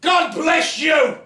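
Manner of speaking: loud, slow shout. Acted. Haman shouting to save the bride, loud slow shout. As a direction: bellow the blessing out. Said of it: "Haman shouted".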